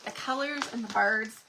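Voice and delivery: ordinary talk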